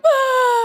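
high, loud imitated child's cry; mock child wail. Children protesting bedtime threat, loud high mock wail. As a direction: wail like a kid told off. Kids' reaction, retold.

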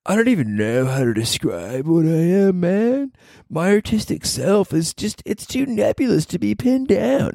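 high, airy mock of a self-important comic; pretentious comedian. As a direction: sneer at the comic's lofty talk. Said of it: "this ego to".

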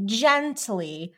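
ordinary talk

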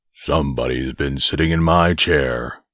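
low, great big bear voice; growling father bear. A narrator's reading. Father bear finding his chair sat in, growling low. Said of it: "growled the father bear in his great big voice".

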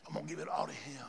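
whispered, very soft. Real, not acted. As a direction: breathe it as a whisper. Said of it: "repeated softer".

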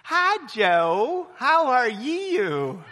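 high, drawn-out Tennessee greeting; Tennessee accent. Retold or imitated. Speaker imitates Tennesseans greeting Joe, slow Tennessee accent. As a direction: stretch "you" into two syllables, Tennessee style. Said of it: "this is how they would greet".

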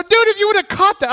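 high, loud and fast call; screaming from the dugout. Retold or imitated. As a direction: yell it across the field. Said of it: "I'm screaming this from the dugout".

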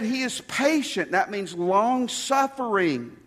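loud, high, emphatic preaching; preaching at full force. Acted. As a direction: drive it home to the congregation.

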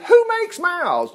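high, slow indignant demand; imitating an exasperated God; acted divine anger. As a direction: thunder the question as an angered Lord, pitched high. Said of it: "the Lord actually got angry".

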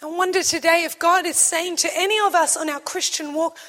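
usual speaking voice

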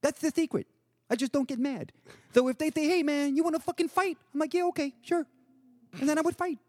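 high, mellow lisping impression; lisping Patrick. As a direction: mimic an unbothered friend explaining himself. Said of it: "like, this little lisp".

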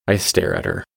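ordinary delivery

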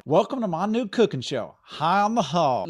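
host intro. Acted. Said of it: "Let's just do it again".

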